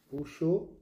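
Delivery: usual speaking voice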